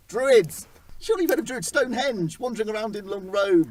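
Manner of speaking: high, loud, insistent Druids!; loud high exclamation. Shown as a demonstration. Host corrects himself and shouts Druids! high and loud. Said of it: "that should have been not druids".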